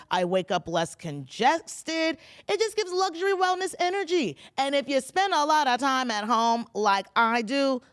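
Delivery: loud, punchy host-read endorsement; sponsor ad read. Acted. Host pitches air scrubber to homebodies, punchy ad read. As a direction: sell it to the listeners with force.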